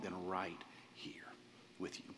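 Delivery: heartfelt hush. Real, not acted. Pastor back from a long trip tells his congregation, hushed and heartfelt. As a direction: drop low, almost to a whisper.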